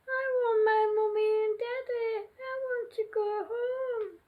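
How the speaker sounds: put-on a tiny girl sobbing for her mummy and daddy; crying child. Homesick baby to dolphin family, high sobbing wail. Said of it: "she just kept crying and saying".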